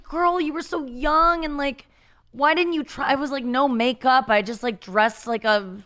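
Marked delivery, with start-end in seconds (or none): 0.0-3.1 scolding younger self. Retold or imitated. Looking back at her younger self, high exasperated scolding. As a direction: chide your past self like a disappointed friend.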